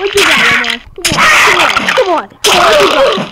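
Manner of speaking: shouting, high and hyped; playful fight-taunt yell; loud taunt. Real, not acted. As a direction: goad him loudly.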